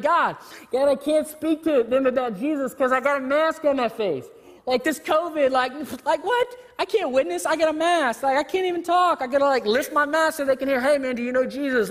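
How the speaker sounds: high, excited pleading with God; mock panic about the mask; frantic excuses. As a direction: pile on the excuses, voice climbing. Frantic believer voiced.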